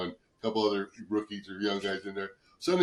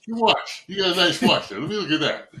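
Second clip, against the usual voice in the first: higher, louder, keen on the watch; a hint of a hustler eyeing loot; eager mock greed; 6 semitones higher, 5 dB louder than usual. [name] sizing up the pager guy's pitch, eager and louder.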